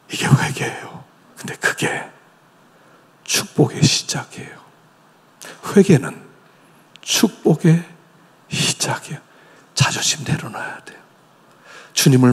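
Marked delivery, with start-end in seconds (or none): 0.1-4.6 soft unvoiced whisper. Real, not acted. Preacher whispers to the congregation about blessing. 8.5-11.0 hushed whisper. Real, not acted. Preacher whispers to the congregation.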